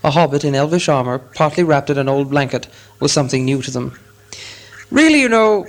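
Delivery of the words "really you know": higher-pitched, businesslike opening. A narrator's reading. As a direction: put on your best business manner. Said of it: "saying in his best business manner".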